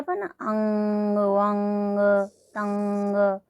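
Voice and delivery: अंग, वंग, तंग held level, ng hummed through the nose; nasal endings shown. Shown as a demonstration. Teacher demonstrating nasal anusvara sounds. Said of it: "इस तरह से बोलते हैं ना".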